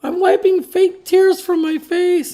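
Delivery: mocking a fan sobbing over Elon; mock fake crying. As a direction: ham up a weepy fan. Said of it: "This girl fake crying".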